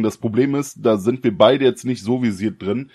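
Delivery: ordinary talk, nothing marked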